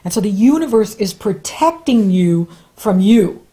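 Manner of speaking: ordinary talk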